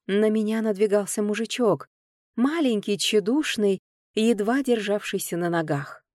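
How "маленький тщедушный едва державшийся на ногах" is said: plain reading voice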